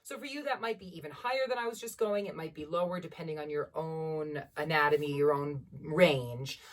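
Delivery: usual speaking voice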